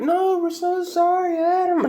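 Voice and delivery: put-on sing-song apologetic kid voices; kids apologizing. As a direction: plead sorry like little children.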